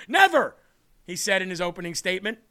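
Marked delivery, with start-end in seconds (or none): 0.1-0.6 emphatic. Acted. Reading quote emphatically.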